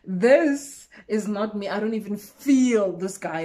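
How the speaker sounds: slow, deliberate, firmer refusal. Retold or imitated. Narrator refusing the guy's advances, slow and firm. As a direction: lay each word down slowly.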